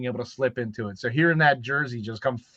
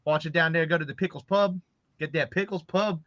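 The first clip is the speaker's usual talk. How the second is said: lively Baltimore accent, fast at the start; chatty Baltimore local talk in a Baltimore accent; 2 semitones higher, faster than usual. Shown as a demonstration. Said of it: "the Baltimore accent really quick".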